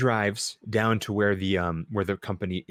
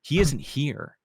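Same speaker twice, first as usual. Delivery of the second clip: dry officer reply; 7 semitones higher than usual. Retold or imitated. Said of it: "acted dialogue".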